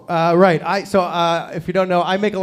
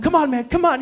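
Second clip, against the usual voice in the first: urging dad; 9 semitones higher than usual. Retold or imitated. Dad urging balking toddler.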